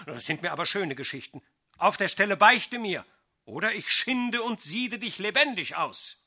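loud, rising to shouts at Du Satan; furious sorcerer. A narrator's reading. Sorcerer furiously demands the cat confess, shouting.

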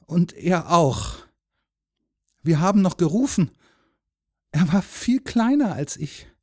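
put-on high, halting child's account; earnest, pleading boyish voice; grieving little boy. A narrator's reading. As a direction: confide the loss in short pieces.

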